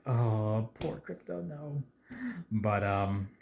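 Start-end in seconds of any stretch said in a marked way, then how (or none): 0.1-1.8 hammed-up little wail of sympathy; pitying lament. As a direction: play up pity for a hurt pet, small and high. Acts out dread for hurt dog.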